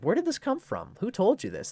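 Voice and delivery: disbelieving, who said that; shocked question. Retold or imitated. Housemates ask the landlord, shocked.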